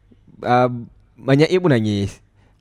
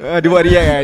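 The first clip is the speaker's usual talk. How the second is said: ordinary delivery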